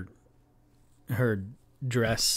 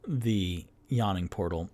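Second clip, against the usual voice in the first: normal talking voice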